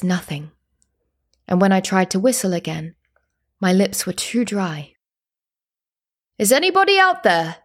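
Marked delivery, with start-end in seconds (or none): 6.4-7.6 high, loud call into the night; calling out, higher. A narrator's reading. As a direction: call out again, more urgently. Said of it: "the man called again".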